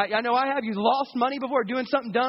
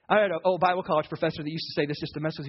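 ordinary talk, nothing marked

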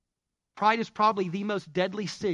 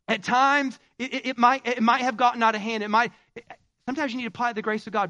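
preaching climax; 6 semitones higher, 6 dB louder than usual. Acted.

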